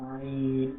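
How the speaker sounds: আইন drawn out from deep in the throat; deep throaty ain. Shown as a demonstration. Teacher demonstrates আইন, long and deep in the throat. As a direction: pull আইন from the throat and hold it. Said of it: "গলা থেকে গভীর আওয়াজ দিয়ে".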